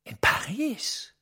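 slow hushed disbelief, rising at the end; surprised whisper. Acted. Surprised reaction to news.

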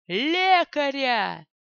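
slow, loud bellowed call; booming bark. A narrator's reading. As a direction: bellow for the medic. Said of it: "зычно гаркнул Вадер".

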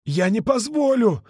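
high, slow blurted refusal. A narrator's reading. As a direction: fire the words out. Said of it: "выпалил Ка". (выпалил с отчаянием)

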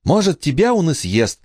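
put-on high-pitched snappish girl; snapping back. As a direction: snipe back at a brother. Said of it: "огрызнулась Эмма".

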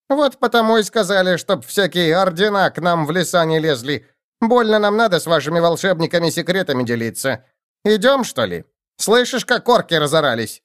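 high excited goblin chatter. A narrator's reading. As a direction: babble like an eager goblin.

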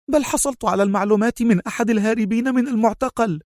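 fast, high-pitched retort; sharp answer. A narrator's reading. Mona answering the consul's doubt about her source, sharply. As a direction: answer back sharply and quickly. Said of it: "أجابته في حدة".

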